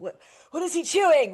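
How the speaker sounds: a quick jolt of panic; alarmed question. Retold or imitated. Panicked owner retelling the scene.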